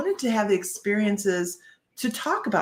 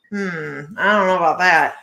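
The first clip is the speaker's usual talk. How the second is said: skeptical hum, then louder dismissive line; doubtful people; 2 semitones lower, 4 dB louder than usual. Retold or imitated. People eyeing her, a skeptical dismissive aside.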